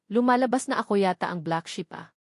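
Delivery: ordinary delivery, nothing marked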